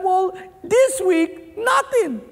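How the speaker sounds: very high, slow fake wail; hammed-up tearful despair on the punchline; mock crying. Crying man admits his complaint to the friend, mock wailing. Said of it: "this guy who was crying".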